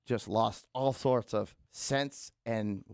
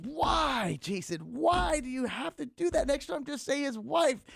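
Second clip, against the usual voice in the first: high, exasperated scolding voice; scolding wife; 12 semitones higher, slower than usual. Retold or imitated. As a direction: scold your husband, exasperated. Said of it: "she's like".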